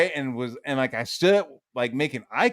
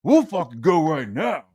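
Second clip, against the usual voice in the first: loud, raised mock challenge; imitating threatening dad; 5 semitones higher, 6 dB louder than usual. Dad challenging his son to a fight, mock angry dare. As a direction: call him out to fight, forceful and high. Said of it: "he was like".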